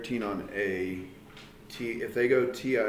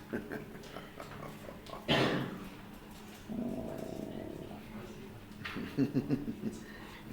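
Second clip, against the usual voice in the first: low, breathy, creepy villain cackle; a soft, sinister evil chuckle; villain laugh; 13 semitones lower, 8 dB quieter than usual. Pretend. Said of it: "That was my creepy laugh".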